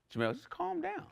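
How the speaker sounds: high, soft imitation of the fiance; fiance's voice. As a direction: play the fiance gently telling him to calm down. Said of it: "She's like".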